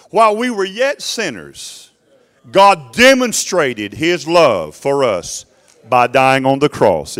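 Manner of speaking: loud, slow, drawn-out preaching; emphatic scripture recitation. Acted. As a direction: proclaim the verse to the congregation, stretching the key words. Recites scripture forcefully while preaching.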